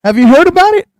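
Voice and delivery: high, loud disbelief; can't believe he doesn't know; shocked that his friend never heard; incredulous. Real, not acted.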